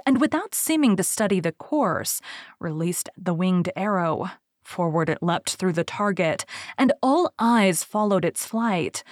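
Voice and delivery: usual speaking voice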